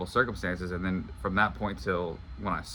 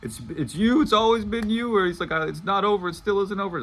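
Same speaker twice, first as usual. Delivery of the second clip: much higher, louder hammed-up romantic declaration; Notebook movie hero; 12 semitones higher, 7 dB louder than usual. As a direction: ham up the swooning film lover's big confession. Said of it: "that scene in The Notebook where he's like".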